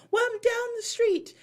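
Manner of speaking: very high, loud put-on accent; airy excuse in a hammed-up fake accent; Dorit's fake mixed accent. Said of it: "every time I do her impression".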